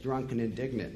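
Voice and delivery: ordinary delivery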